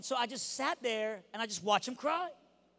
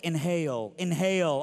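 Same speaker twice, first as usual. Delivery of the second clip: coaching to breathe; 5 semitones lower, 6 dB louder than usual. Acted. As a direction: urge a sobbing kid to breathe, low. Coaching a sobbing kid to breathe.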